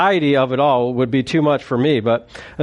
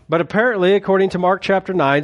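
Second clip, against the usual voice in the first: normal talking voice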